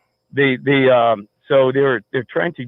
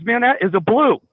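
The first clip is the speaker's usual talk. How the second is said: imitated alarm, pitched much higher; retold alarmed warning; 11 semitones higher than usual. Roommate spotting an undercover police car, imitated alarm. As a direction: insist on it in a high, urgent voice.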